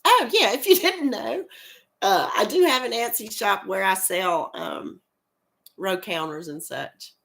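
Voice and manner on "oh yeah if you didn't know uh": ordinary talk, nothing marked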